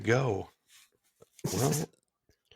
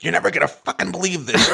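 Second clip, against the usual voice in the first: higher-pitched, worked-up phone opener; mock excited caller; 7 semitones higher than usual. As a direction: imitate a caller bursting into news. Imitating Joe's abrupt excited phone opening.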